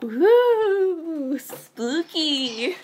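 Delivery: loud, high wailing spooky ghost; mock ghost. Teasing a scared friend after the story, mock ghost. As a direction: wail it playfully like a cartoon ghost.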